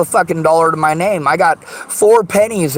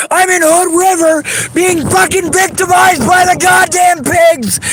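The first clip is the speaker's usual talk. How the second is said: furious shouting; 8 semitones higher than usual. Real, not acted. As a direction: yell at them with real fury. Furious rant at the listeners.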